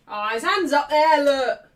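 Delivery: loud vindicated outburst; loud protest. Real, not acted. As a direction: call out the evidence indignantly. Protesting at replay of handball.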